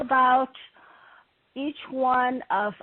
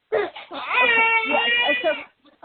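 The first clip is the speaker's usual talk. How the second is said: high, tearful, breaking voice; choked up crying; 9 semitones higher, much slower than usual. Real, not acted. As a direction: voice cracking with tears. Host moved to tears by caller.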